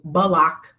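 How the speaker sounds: Block stretched into two syllables, buh-lock. Shown as a demonstration. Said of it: "One word, two syllables".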